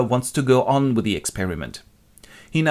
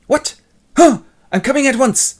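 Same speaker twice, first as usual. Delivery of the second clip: high, loud shock; alarmed reaction; 8 dB louder than usual. Acted. John hears on the phone his mother is dying, alarmed. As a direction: blurt it out in alarm.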